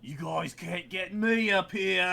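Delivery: shouting down. Acted. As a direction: call down, teasing. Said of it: "Princess will shout down".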